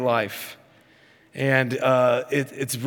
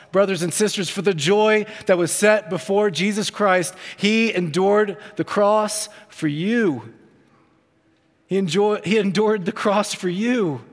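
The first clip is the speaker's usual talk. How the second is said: full-force preaching; 6 semitones higher than usual. Acted. Preacher presses the cross on brothers and sisters, preaching full force. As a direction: drive it home to the congregation.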